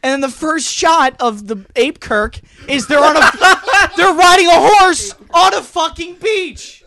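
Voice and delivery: high, loud, cracking up; real laughing rant. Real, not acted. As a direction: rant through helpless laughter. Cracking up over the two trailers.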